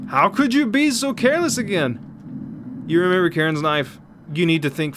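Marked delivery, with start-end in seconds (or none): 0.1-2.8 high and slow, exasperated; exasperated high scolding. Acted. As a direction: scold them for being careless. Character scolding.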